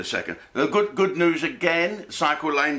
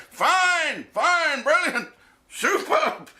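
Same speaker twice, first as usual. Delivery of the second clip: very high, over-eager mock approval; mock frightened praise; much slower than usual. Crew reassuring scary Mike Tyson about his singing, mock frightened praise. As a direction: squeak out exaggerated praise to placate someone scary.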